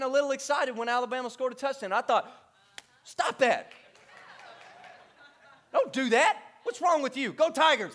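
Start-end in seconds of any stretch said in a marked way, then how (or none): 3.0-7.9 mock stern self-scolding; half-joking rebuke to himself. Pastor scolding himself for cheering Alabama, mock stern. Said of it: "I had to say it out loud".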